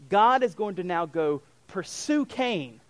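ordinary talk, nothing marked

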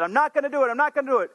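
high, insistent mock protest; mock Peter's vow. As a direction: play Peter swearing he won't.

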